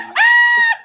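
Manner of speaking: sudden high-pitched burst of laughter; squealing laugh. Real, not acted. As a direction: shriek with laughter at your own joke. Laughs after teasing the young crowd.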